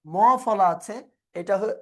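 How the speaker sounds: ordinary talk, nothing marked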